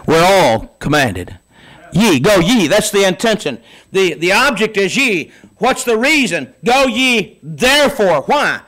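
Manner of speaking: preacher's rising thunder, drawn out; full-force preaching. Acted. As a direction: pound it home from the pulpit. Preacher building to a climax.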